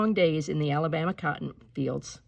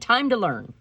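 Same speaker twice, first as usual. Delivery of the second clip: determined; 5 semitones higher, 5 dB louder than usual. A narrator's reading. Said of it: "she decided".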